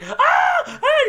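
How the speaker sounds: very loud, high imitated yell; high-pitched scream. Imitating a dude in a gaming clip, screaming. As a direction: shriek like a startled gamer. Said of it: "and he's like".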